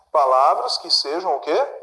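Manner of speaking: usual speaking voice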